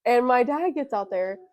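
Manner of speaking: normal talking voice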